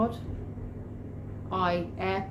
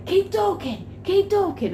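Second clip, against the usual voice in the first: high mock calling; hammed-up frantic order; urgent boss; 10 semitones higher than usual. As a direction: imitate him insisting, voice way up. Rob calling as she bends behind.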